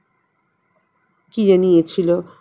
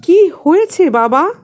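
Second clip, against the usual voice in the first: high, louder bewildered question, stress on কি; surprised; 8 semitones higher, 5 dB louder than usual. A narrator's reading. Boy sees father covered in cotton, startled question. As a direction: ask in startled wonder. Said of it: "আমি অবাক হয়ে জিজ্ঞাসা করলাম".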